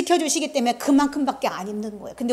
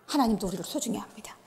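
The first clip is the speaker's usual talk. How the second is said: soft repeat; 4 semitones lower, 11 dB quieter than usual. Acted. Repeated softly.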